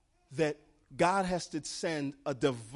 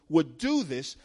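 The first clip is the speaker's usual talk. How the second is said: ordinary delivery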